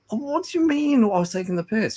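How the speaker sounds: retold indignant question. Husband retelling arguing with his wife, high indignant. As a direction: sound offended and baffled, up high.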